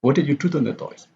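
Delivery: quick question falling from "do", "toys" unstressed. Shown as a demonstration. Teacher repeats for class, fall on "do", quick. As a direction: hit "do" and drop "toys". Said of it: "toys here is not stress".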